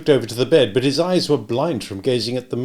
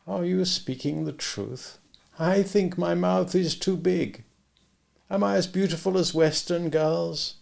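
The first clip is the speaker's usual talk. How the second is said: a hint of a higher, prim young Russian woman; lightly voiced Tatiana; 8 semitones higher than usual. A narrator's reading. Tatiana, shy and earnest, answers Bond, lightly voiced. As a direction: lightly play the earnest girl, primly correcting him.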